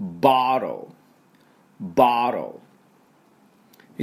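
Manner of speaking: ボトル as "bah-ro", t barely sounded; American bottle. Shown as a demonstration. Teacher showing how Americans say it, ボトル as "bah-ro". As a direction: open "bah", flapped t in ボトル. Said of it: "私の後について発音いきましょう".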